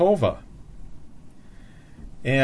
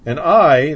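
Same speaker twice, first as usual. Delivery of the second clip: loud, weighty stress on "I"; "I" rung out louder than the rest; emphatic I; 6 dB louder than usual. Shown as a demonstration. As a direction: hit "I" hard. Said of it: "that also is emphatic".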